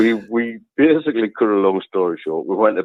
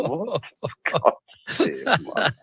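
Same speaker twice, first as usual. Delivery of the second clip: mild retold exasperation; 9 semitones higher, 6 dB quieter, much slower than usual. Shocked by wire in loft.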